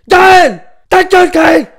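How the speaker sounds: furious yell at Gaël; angry shout. Acted. Yelling at Gaël to shut up, furious shout. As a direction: bark his name at full volume.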